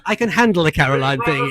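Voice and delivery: normal talking voice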